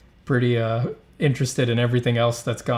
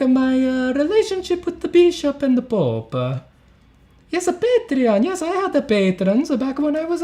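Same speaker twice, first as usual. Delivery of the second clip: very high, hammed-up Italian accent; squeaky-high mock-Italian voice; bad Italian accent; 13 semitones higher than usual. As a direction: play an old master in a terrible high Italian accent. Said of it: "let me do the bad Italian accent".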